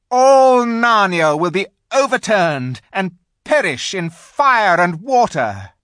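high, loud, threatening witch tirade; savage witch. A narrator's reading. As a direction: rise to a loud, doom-laden threat. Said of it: "said the witch with a savage smile".

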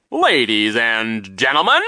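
slow, grand, loud announcer's call; loud announcement. A narrator's reading. Man announcing himself to three children, loud and grand. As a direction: draw it out like a showman. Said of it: "announced in a loud voice".

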